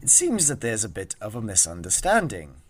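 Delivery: measured, reasoning character voice; composed adventurer smoothing over a misunderstanding; Arthur, diplomatic. Acted. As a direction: talk down an armed stranger politely. Plays Arthur.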